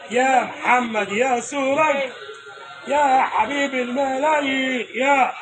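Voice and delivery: slow, stretched call with a laugh; a slow, teasing hail to a friend; drawn-out affectionate call. Real, not acted. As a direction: draw out each name fondly, laughing. Calling out to Mohamed Serag.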